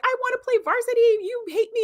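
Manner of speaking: high-pitched mock teen tantrum; daughter freaking out. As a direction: imitate a daughter wailing in protest, way up high. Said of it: "the daughter's all freaking out".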